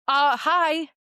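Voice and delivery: mock-incredulous comeback; duh retort. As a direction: answer like it's blindingly obvious. Retold obvious-answer retort to sister.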